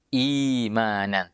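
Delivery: imanan drawn out slowly; slow result form. Shown as a demonstration. Teacher slowly sounds the final imanan. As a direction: stretch out imanan carefully. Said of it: "jadinya bunyinya".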